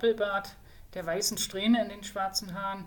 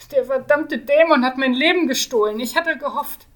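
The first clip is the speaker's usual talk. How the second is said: higher and much louder lament; anguished outburst; 6 semitones higher, 13 dB louder than usual. Acted. The mage mourning his lost years, loudly. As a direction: rail against the demon.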